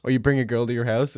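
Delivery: normal talking voice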